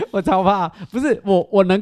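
real laughter while owning up to being scared; laughing. Real, not acted. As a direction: giggle out a confession of fear. Laughing while admitting fear.

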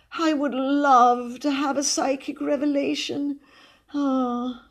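high, affected spiritualist lady; wistful sighing. A narrator's reading. Cousin longs for a psychic revelation, sighing. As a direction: yearn theatrically, ending on a sigh. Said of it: "she sighed again".

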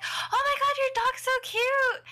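very high-pitched cooing over a dog; mock gushing. Friends telling owner his dog is cute, high gushing. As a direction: gush in an exaggerated high squeal.

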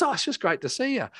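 high-pitched mock gushing; effusive friend. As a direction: imitate a delighted, over-affectionate friend up high. Acts friend's effusive reply.

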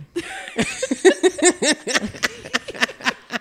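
high, helpless laugh; high burst of laughter. Real, not acted. Laughing hard at the friend's name joke.

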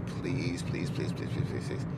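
whispered pleading please; begging whisper. Retold or imitated. Begging the girl to let him visit, whispered. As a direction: beg her under your breath. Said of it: "And I was like".

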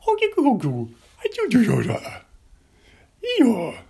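high, loud goofy Sandler yelp; Adam Sandler voice. Retold or imitated. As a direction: squeal it like a goofy comedian. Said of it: "I just started doing Adam Sandler".

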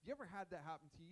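ordinary talk

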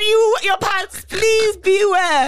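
slow, loud mock warning; hammed-up warning. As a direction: drag out the warning, teasing. Mock warning about Joseph.

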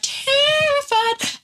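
"terrified" stretched slow in a high squeak; drawn-out high "terrified". Shown as a demonstration. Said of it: "I was terrified".

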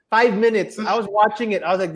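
ordinary delivery

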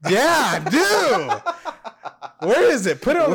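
high, loud playful demand. Real, not acted.

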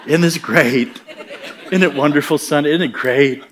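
chuckling, delighted; the Lord giggling in his ear. Retold or imitated. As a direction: laugh through the words with joy. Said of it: "the Lord starts giggling in my ear".

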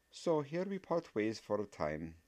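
a stern witch teacher parting. Acted. Voiced professor character.

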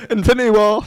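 high, loud hyped squeal; mock excited shout. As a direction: shout it like a fanboy.

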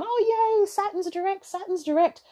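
high, squealy mock excitement; mocking excited crowd. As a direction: squeal it like a gushing fan. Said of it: "when everyone carries on".

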